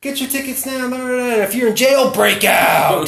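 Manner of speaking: high hyped ad read, shouted ending; monster truck ad. Pretend. Continuing the monster truck ad parody.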